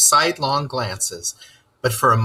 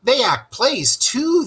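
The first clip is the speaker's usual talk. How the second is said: lightly voiced Mole; 12 semitones higher, 4 dB louder, slower than usual. A narrator's reading. Mole telling Rat.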